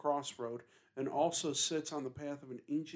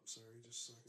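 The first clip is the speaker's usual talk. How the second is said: embarrassed whisper; 18 dB quieter, much faster than usual. Real, not acted. Embarrassed, hentai pulled up.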